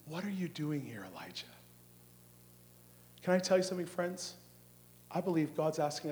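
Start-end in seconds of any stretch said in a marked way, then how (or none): 0.1-1.5 soft question, dropping low at the end; still small voice. Acted. God asks Elijah at the cave, soft still small voice. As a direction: ask gently, sinking away on the name. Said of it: "suddenly a voice came to him".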